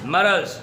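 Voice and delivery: मर्ज as two syllables, "ma-raz"; correct pronunciation. Shown as a demonstration. Teacher answering his question, correct pronunciation. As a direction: put a short a after the r in मर्ज. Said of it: "क्या होता है?".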